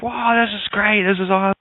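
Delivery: high-pitched, hammed-up thrilled joke character; totally excited. Guy bouncing into a bar, acted total excitement. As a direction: gush like an overexcited bar customer. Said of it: "he's totally excited. He's like".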